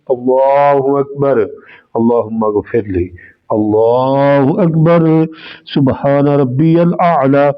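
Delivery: slow, soft, low recited prayer; prayer recitation demo. Shown as a demonstration. Preacher showing the congregation how to pray, slow soft recitation. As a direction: recite the prayer slowly as a practical demonstration. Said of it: "practical طریقہ بالکل آسان".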